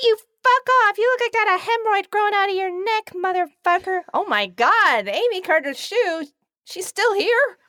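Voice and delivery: very high-pitched cartoonish cursing outburst; high put-on ranting voice. Co-host hurling absurd insults at sign-off, high put-on rant.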